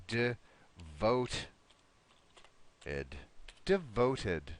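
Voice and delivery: "devoted" broken slowly into syllables, short first syllable; slow syllable demo. Shown as a demonstration. Teacher shows student how "devoted" sounds, slow syllable demo. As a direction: say "devoted" with a short, reduced "de" and clear "-ed". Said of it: "It's gonna sound like this".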